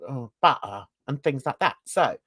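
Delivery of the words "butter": butter with the T dropped. Shown as a demonstration. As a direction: swallow the T in butter. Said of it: "speaks as if they drop their T's".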